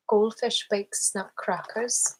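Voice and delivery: slow Irish read drifting into Scottish; Irish accent turning Scottish. Shown as a demonstration. Streamer reading aloud to show Irish accent, Scottish-sounding Irish. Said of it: "This kinda sounds Scottish".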